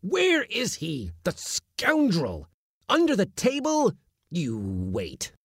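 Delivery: high, slow menacing search; menacing hunt. A narrator's reading. Uncle hunting the kitten under the table, high and slow. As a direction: threaten the hiding kitten.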